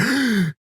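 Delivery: sharp high gasp of fright; startled gasp. Retold or imitated. Friend imagines the kids caught by mom, sharp gasp. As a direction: suck in air as if caught off guard. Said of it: "You're like".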